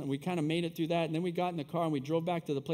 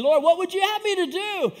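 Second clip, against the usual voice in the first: high, loud preacher's cry as Paul; calling out to God; 11 semitones higher, 10 dB louder than usual. Acted. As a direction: cry it out to the Lord.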